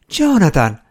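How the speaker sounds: gasping his name in surprise; astonished call. A narrator's reading. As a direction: call out to someone unexpectedly found. Wife sees long-lost husband. (chiamata stupita)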